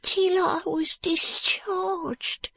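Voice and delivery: high, slow whisper; a frightened boy whispering his secret. Acted.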